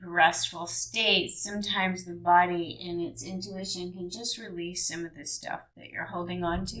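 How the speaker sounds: usual speaking voice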